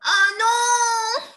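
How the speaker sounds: high, loud frightened squeal; little worm crying. Acted. The worm seeing danger, high frightened cry. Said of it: "Qu'est-ce que le ver crie?".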